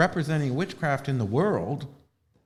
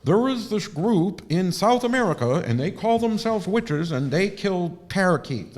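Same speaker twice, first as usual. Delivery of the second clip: mock TV statement; 7 semitones higher, 6 dB louder than usual. Mocking herself on CNN, hammed-up loud blurt. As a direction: pretend to blurt it to the camera. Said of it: "with C N N and the fucking camera in my face and go".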